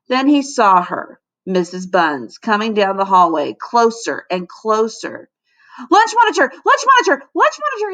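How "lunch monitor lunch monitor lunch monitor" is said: put-on much higher, louder child's cry; cried warning. A narrator's reading. Melvin spotting Missus Buns in the hallway, high alarmed cry. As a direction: call the alarm high and urgent. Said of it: "he cried".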